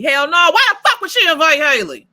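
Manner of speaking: loud, high disbelieving outburst; loud incredulous exclamation; outraged yell. Real, not acted. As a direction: shout it in scandalized disbelief.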